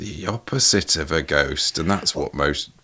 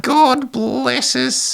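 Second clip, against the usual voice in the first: even higher, drawn-out squeaky Aussie spirit; Australian little spirit; much slower than usual. Acted. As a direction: stretch it out in a sad, creepy, squeaky Australian accent. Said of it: "make it a little more Australian".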